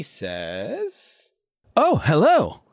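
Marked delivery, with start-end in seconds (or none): 1.8-2.6 high, loud mimicked greeting; Scrib. Talking bird greets Marco, high loud borrowed voice. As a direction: pipe it up loudly, pitch swooping. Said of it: "in a voice that sounds like Scrib".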